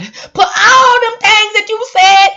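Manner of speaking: high, loud, slow wailing cry; hammed-up sobbing shout; mock wailing prayer. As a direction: wail it like a weeping preacher.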